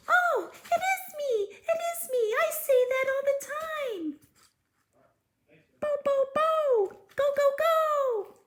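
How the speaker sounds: very high squeaky fairy chatter; fairy puppet voice. Acted. Fairy puppet Sparkle chatting with the reader, very high. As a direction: chirp happily as the little fairy.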